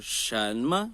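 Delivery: "什么" rising, then a light short "么"; second plus neutral tone. Shown as a demonstration. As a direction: lift "什" and let "么" fall away. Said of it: "Segundo e neutro". ("什么": sobe, e o segundo leve)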